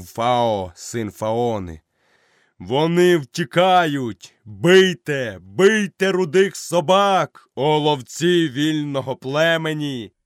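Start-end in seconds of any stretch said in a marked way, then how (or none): 2.6-10.1 high, loud, slow rallying yell; hammed-up war cry urging the hunters on; battle cry. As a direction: rouse the pack to attack.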